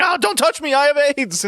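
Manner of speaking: high, squealing mock panic; hammed-up high-pitched fright; panicky mock voice. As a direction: squeal it like a scared man. Mocking a panicked Lucky.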